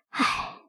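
quiet breathy sigh of pity; soft sigh. A narrator's reading. Two women lament a little girl, sighing softly. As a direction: let out a hushed, sorrowful sigh. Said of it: "两个人唉声叹气的".

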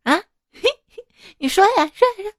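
high, playful mock whine with giggles; coy whining wife. A wife pestering her husband, coy and giggly. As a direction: tease him like a spoiled wife. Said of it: "我就撒娇跟老公".

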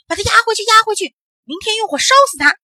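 high, loud raging official; furious yelling. A narrator's reading. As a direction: scream orders in a fury. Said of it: "咧着大嘴哇哇乱叫". (气得哇哇大叫)

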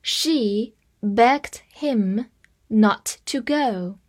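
slow, phrase-by-phrase reading. Shown as a demonstration. Teacher reading slowly for students to repeat. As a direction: read it slowly in two chunks. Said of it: "这句话慢慢跟读一遍".

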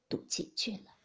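ordinary delivery, nothing marked

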